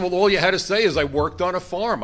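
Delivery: a hint of a boastful cabbie; retold Lebanese driver. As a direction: pitch it up, retelling the driver's boast. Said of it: "the driver was from Lebanon".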